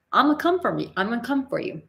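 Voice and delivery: high, punchy threat; mock-fierce vow; defiant warning. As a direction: warn them you're coming. Retold warning to gossipers.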